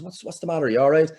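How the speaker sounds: mild worried question; Marion asking. Retold or imitated. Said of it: "she turned to me and she says".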